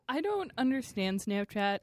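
ordinary delivery

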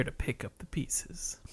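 playful conspiratorial whisper. Pretend. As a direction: hush it like sharing a secret. Conspiratorial aside about lurking ogres.

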